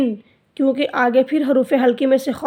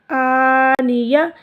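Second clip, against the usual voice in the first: normal talking voice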